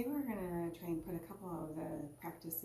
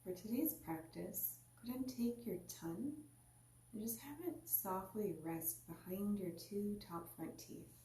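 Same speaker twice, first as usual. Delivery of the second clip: guided meditation. Acted. Teacher guiding listeners into their seat, calm meditation voice. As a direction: ease the listener inward, unhurried.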